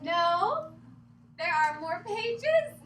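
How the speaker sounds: loud, high, slow mock-stern reply; loud insistence. Reader answering own plea, loud and slow. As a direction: lay down the law, hammed-up.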